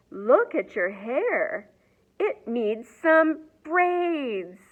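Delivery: slow, high grandmotherly voice for the braids line; drawn-out doting grandma scolding hair; bossy grandma. Acted.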